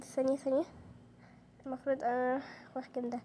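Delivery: usual speaking voice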